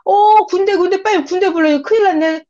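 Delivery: high, loud cry of alarm, half sobbing; panicked shout. Acted. Elisha crying out as his master vanishes, high panicked shout. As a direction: scream to God that disaster has struck. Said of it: "막 소리를 지릅니다".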